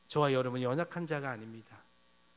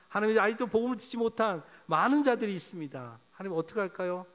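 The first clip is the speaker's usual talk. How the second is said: louder, higher cry of alarm; 6 semitones higher, 6 dB louder than usual. Pretend. Preacher praying for the church, crying out loud. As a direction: cry it out to God. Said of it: "탄식해야 됩니다".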